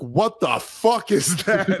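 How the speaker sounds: put-on teen boys gawking at a drag queen; shocked kids. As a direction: drag it out in disbelief. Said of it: "James and Max were like".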